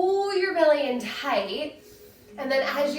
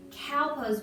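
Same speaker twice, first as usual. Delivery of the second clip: usual speaking voice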